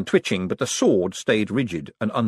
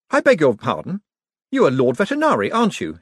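deferential courtesy; 6 semitones higher, 5 dB louder than usual. A narrator's reading. Moist humouring Cosmo.

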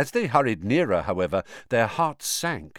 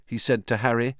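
plain reading voice

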